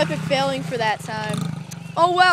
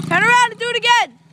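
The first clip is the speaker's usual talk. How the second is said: loud, higher call across the yard; 6 semitones higher, 5 dB louder than usual. Real, not acted. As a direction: shout the order to him far off. Calling to brother on bike.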